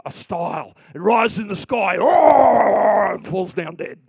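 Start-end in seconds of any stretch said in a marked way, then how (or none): 2.0-3.1 mock dying roar. Dragon dies roaring.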